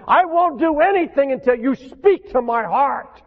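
loud, high pleading prayer to Jesus; fervent prayer. Acted. Preacher shows the congregation his prayer, loud and pleading. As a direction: cry out to Jesus, refusing to move without an answer.

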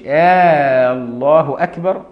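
first vowel of الله drawn out long, like a question; "آه الله" with a long held opening "aaa"; lengthened opening hamza. Shown as a demonstration. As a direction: stretch the initial آ of الله loudly before أكبر. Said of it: "وعدم مد همزة الجلالة، ما يقولش".

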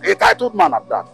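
loud boast. Acted. Chief boasts loudly.

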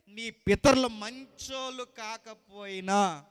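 high, loud thundering sermon; fiery preaching. Acted. Preacher driving the point to his congregation, thundering. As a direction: hammer every line home to the church.

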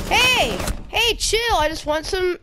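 loud high panicked yelling; frat bro. Real, not acted. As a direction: yell hey chill in alarm. Attacked in the kitchen.

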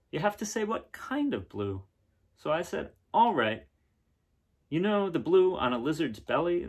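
brighter, livelier read-aloud voice; story narration. A narrator's reading. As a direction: read it to the kids with wonder. Reads picture book aloud.

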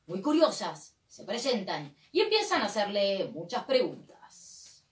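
storyteller voice for an audience; story narration. Acted. Performer narrating the clowns' adventure, storyteller style. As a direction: narrate the show's next scene.

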